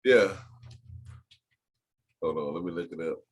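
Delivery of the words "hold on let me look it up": suddenly low, quiet voice; deep voice. Real, not acted. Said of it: "your voice got deep real quick".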